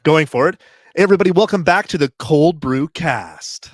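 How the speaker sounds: big booming hype announcer; loud arena announcer, selling the show. Acted. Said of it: "In your announcer voice".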